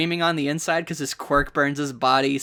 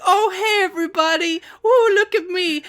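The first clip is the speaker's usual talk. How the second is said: much higher, louder, hammed-up show-off villain; mock show-off; 5 dB louder than usual. Fan imitates Dabi showing off to everyone, high hammed-up squeal. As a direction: squeal it like a goofy villain begging for attention.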